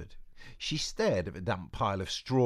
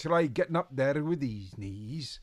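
put-on higher, louder old witch voice; Boris; 6 semitones higher, 6 dB louder than usual. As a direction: snap back with dignity. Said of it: "I've lost my accent now".

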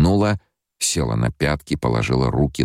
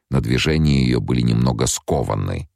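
plain reading voice

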